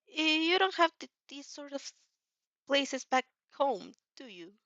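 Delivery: lightly voiced shy young man, stammering; shy Izuku. A narrator's reading. Shy Izuku asks her about home, stammering lightly.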